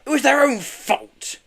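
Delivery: high, slow protest. Acted. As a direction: protest his innocence. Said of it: "he protested".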